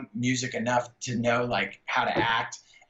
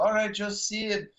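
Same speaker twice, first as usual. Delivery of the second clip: high, slow imitated Mexican accent; 7 semitones higher, slower than usual. Lighting man greets Joe Sib by name, Mexican accent. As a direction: draw it out in a put-on Mexican accent. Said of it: "had this really thick Mexican accent".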